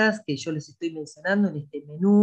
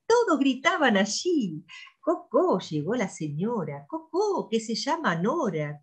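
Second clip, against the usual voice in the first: lively sing-song read-aloud with high clucking cocó calls and animal noises; reading to children; 7 semitones higher than usual. Acted. Teacher reading a farm poem to children, playful sing-song. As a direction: read it to little kids with high hen calls and barnyard sounds.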